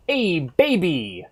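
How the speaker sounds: put-on high, slow, loud Hey baby; hammed-up anime dub line; dub English quote. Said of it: "The English is just so fun".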